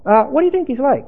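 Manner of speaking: quick, keen microphone question; eager street reporter. Acted. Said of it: "we're gonna interview".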